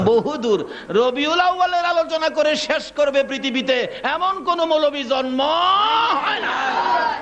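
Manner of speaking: high-pitched sermon chant; impassioned preaching. Real, not acted. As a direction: proclaim it high, drawing out the end. (উঁচু গলায় আবেগি ওয়াজ)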